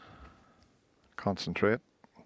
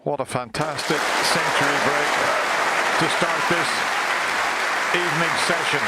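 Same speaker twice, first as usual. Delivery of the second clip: raised, loud, thrilled call; excited; 7 semitones higher, 9 dB louder than usual. Real, not acted. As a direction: cry out in delight at the century. Commentator celebrating the century break.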